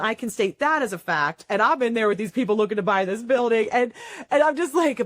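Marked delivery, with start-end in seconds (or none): none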